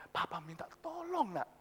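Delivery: soft, hesitant plea; reluctant. Acted. Father asking his child for help, hesitant and soft. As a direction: ask softly, shy to impose. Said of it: "Papa jangan nadanya sungkan gitu".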